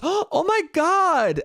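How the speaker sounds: high, drawn-out mock shock; gasp, then stretch it out in fake horror; slow, hammed-up Karen outrage; scandalised Karen. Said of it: "as a Karen does".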